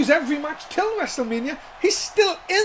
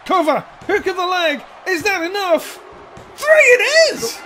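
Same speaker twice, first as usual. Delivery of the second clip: excited play-by-play; 6 semitones higher, 6 dB louder than usual. Real, not acted. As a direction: voice climbs to a roaring three count. Calling the pin count.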